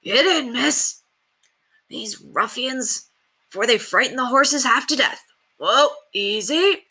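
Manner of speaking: put-on 1 old coachman. A narrator's reading. Coachman urging Penelope in.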